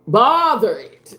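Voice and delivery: slow, loud, drawn-out preaching; preacher voice. Shown as a demonstration. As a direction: belt it out like a pastor. Repeated phrase, plain then preached.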